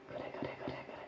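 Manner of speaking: gloating Satan whispering approval; barely audible, voiceless egging-on; sly whisper. Retold or imitated. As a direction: whisper it behind someone's back. Voices Satan grinning behind the person.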